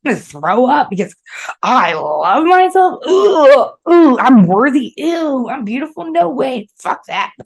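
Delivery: high, loud mocking scorn with a fake gag; mock disgust. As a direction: sneer the affirmations like they make you sick. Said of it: "I was getting angry because I was like".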